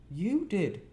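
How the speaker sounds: put-on small boy. A narrator's reading. Young Pip answering his sister.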